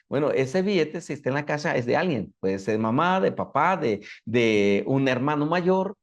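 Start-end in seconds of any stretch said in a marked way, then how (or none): none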